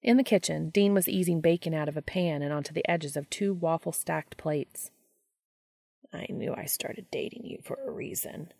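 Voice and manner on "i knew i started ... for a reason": unvoiced, sleepy murmur; sleepy happy rumble. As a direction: breathe it out happily, half awake. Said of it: "Castiel rumbled happily".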